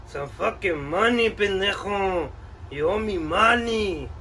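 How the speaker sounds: put-on laid-back Chicano street accent, ese and vato. Collector insists.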